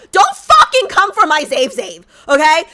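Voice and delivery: furious outburst. Real, not acted. Streamer defending Zave Zave from trolls, loud and furious. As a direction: bark it out in protective fury.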